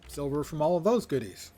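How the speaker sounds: ordinary delivery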